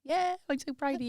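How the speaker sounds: high-pitched imitation of a gushing dad; imitated dad full of pride; proud dad. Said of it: "Dad would be like".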